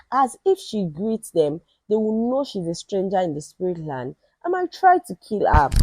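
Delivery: plain reading voice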